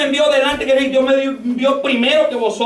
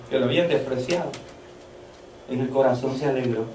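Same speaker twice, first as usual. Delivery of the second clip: much lower, soft, grave storytelling; preacher softly recounting José's bitter weeping; somber sermon; 10 semitones lower, 7 dB quieter than usual. Acted. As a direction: hush the church with José's grief. Sermon drops to somber retelling.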